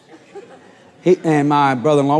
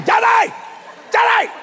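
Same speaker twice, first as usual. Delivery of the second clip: shout. Retold or imitated. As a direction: scream for her father in panic. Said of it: "There's Kathy going".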